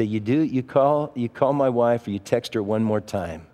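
low, quiet, controlled warning; calmly laying down a threat. Retold or imitated. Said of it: "just talking very calmly".

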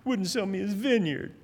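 a touch of a sulky, whiny king; mock whine. Ahab sulking to Jezebel about the vineyard, lightly whining. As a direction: whine it like a pouting child. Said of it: "he kind of whines and says".

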